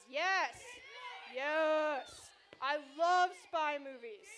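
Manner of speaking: loud, drawn-out excited yes; rally the room with a big yes. Real, not acted. Youth leader asking teens about spy movies, loud excited yes. Asking kids to raise hands.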